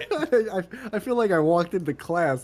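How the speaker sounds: high, laughing out of embarrassment; embarrassed laugh. Real, not acted. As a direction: laugh it off, mortified. Embarrassed laugh after mistake.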